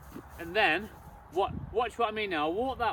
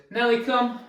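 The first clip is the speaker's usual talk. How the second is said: a brisk, slightly raised recall call; calling the dog; 5 semitones higher, 4 dB louder than usual. Shown as a demonstration. Trainer calling the dog back down the hall, raised call. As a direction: call her in clearly and firmly. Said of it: "all we say is".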